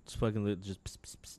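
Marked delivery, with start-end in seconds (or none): 0.8-1.2 soft whispered squirting noise; water pistol squirts. Retold or imitated. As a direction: imitate tiny water squirts under the breath.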